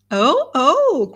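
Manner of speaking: loud, high-pitched "oh, oh" of delight; crying out. A narrator's reading. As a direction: exclaim it like a thrilled gossip. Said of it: "cried Missus Jennings".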